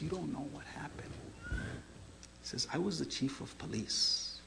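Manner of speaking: hushed, heavy retelling of a confession; tearful confession. Retold or imitated. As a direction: confess softly, with a touch of tears. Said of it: "He starts crying".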